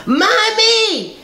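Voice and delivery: put-on high, thin little child calling out; small child's voice. Unborn baby calling to his mother in a high child's voice. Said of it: "And the voice says".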